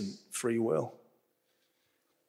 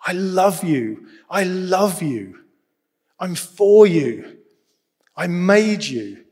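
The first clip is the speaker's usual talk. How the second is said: tender voice of God; 3 semitones higher, 4 dB louder, slower than usual. Pretend. God speaking to his child, slow and tender. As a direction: say it slowly like God speaking love. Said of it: "He wants to whisper to you".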